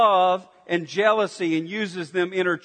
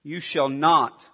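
ordinary delivery

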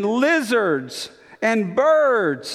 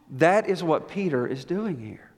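ordinary talk, nothing marked

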